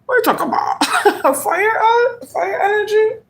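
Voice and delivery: laughing disbelief. Real, not acted. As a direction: giggle out incredulous questions. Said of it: "King of Wands made me laugh".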